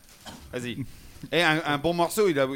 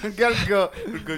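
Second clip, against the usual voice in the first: ordinary delivery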